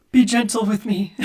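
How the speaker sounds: playful plea for mercy, lightly put on; meek little guy. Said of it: "and I look at you of just".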